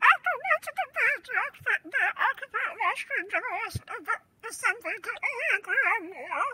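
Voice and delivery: put-on high voice-changed old woman reading; old lady voice changer. Shown as a demonstration. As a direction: read the card through an old lady filter. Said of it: "because of my old lady voice".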